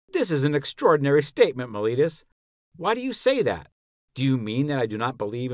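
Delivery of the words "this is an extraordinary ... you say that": mildly astonished, pitch leaping on the question. A narrator's reading. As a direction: read as a taken-aback challenge to the accuser. Socrates astonished at Meletus' charge.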